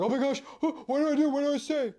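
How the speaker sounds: high-pitched panicked gasp, imitating a frozen beginner; hammed-up nervous freakout; mock panic. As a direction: play the flustered newbie freaking out. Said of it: "you might freeze them and be like".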